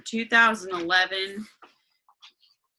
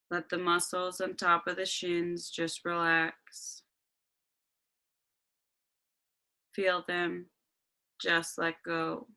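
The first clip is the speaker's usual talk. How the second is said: guided meditation. Acted.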